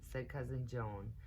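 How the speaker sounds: ordinary delivery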